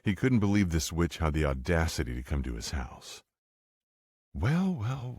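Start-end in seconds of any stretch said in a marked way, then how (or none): 4.3-5.2 lustful. A narrator's reading. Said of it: "she said a bit too lustfully".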